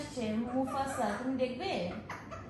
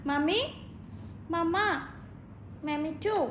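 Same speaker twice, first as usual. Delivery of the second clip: ordinary talk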